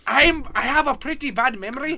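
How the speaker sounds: high-pitched put-on voice; Maura Higgins. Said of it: "And Maura's".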